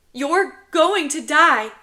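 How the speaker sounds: high, loud, slow wail; upset little boy protesting; tearful child. Acted. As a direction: wail it like a hiccuping kid. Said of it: "Another hiccup".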